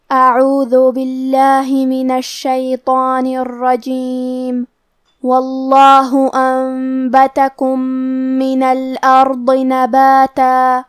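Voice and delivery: slow, melodic tajweed chanting; Quran recitation. Shown as a demonstration. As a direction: draw out each vowel in recitation.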